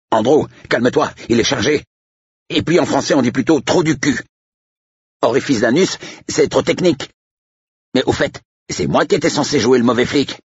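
very high-pitched, agitated sidekick; flustered protest far up in pitch; alarmed Manier. Acted. Manier calming Andrew who holds a loaded gun, flustered.

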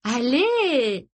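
high, drawn-out nudge to join in; coaxing encouragement. A narrator's reading. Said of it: "l'encouragea Izzy d'un petit coup de coude". (encouragement insistant, voix aiguë)

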